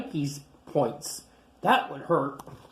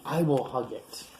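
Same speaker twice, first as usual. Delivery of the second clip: plain reading voice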